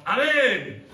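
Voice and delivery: high, loud joyful amen; Silas shouting amen. Acted. Silas beside Paul answering his prayer, loud joyful shout. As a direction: burst out with an agreeing cry. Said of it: "Y Silas".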